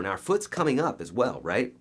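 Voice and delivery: ordinary delivery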